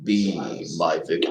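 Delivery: low, rumbling "Be my victim" in a menacing bass; Candyman impression. Fan tries the villain's line while watching. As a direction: drop deep and draw it out like the hook-handed killer. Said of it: "I can't do the "be my victim" very good".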